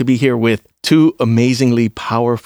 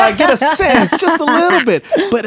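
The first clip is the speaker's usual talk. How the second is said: laughing; 13 semitones higher than usual. Real, not acted. Teasing banter about being strong.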